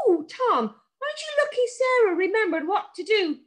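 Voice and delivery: very high, tiny sprite voice; a little magic creature teasing kindly; hedge sprite. A narrator's reading. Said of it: "said Mister Brambles".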